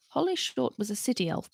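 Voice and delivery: usual speaking voice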